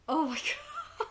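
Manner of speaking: high, laughing disbelief; laughing oh my god. Retold or imitated. Recapping a shocking Archer scene, laughing. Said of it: "I was like".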